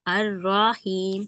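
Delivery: अर्रहीम drawn out slowly; teacher modeling अर्रहीम; slow recitation. Shown as a demonstration. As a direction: recite अर्रहीम slowly, one syllable at a time. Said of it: "For example क्या था".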